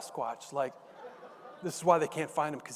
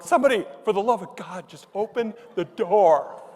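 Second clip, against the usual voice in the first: mock desperate plea, higher and building louder; escalating panic; 3 semitones higher, 7 dB louder than usual. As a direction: start rushed, then drag out the final words. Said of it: "then it just escalates".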